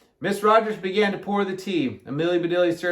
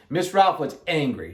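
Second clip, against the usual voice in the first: ordinary delivery, nothing marked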